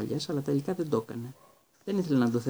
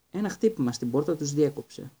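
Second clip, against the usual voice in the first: plain reading voice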